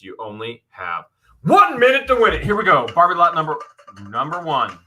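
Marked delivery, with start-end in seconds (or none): 1.4-4.8 loud, high-pitched auction announcement; auctioneer call. Real, not acted. Host starting a one-minute auction, auctioneer call. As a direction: kick off the bidding with urgency.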